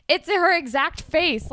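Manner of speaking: loud, high accusing retort; mock indignation. Hazel to Jimmy about the mural, mock indignation. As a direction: hammer it out, imitating an outraged girlfriend. Acts out girlfriend accusing artist boyfriend.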